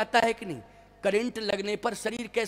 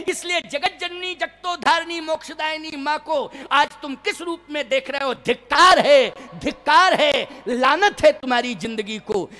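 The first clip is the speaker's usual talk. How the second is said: raised, higher-pitched condemnation; indignant rebuke; 6 semitones higher, 8 dB louder than usual. Acted. Good kings shaming the lustful kings, indignant rebuke. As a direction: denounce them with rising force.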